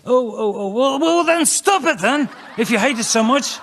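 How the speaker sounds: high, loud mock outburst; exasperated scolding. Comic telling a tormented rock star to quit, exasperated shouting.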